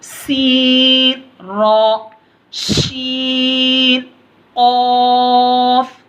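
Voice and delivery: each letter name stretched long and sung out; drawn-out letter recitation. Shown as a demonstration. Teacher showing pupils how to recite letters, long and drawn out. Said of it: "teacher reciting letter names".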